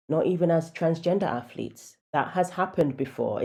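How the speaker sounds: ordinary talk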